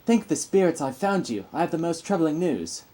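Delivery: anxious hero voice; high character voice. Acted. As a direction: voice a worried game hero calling out.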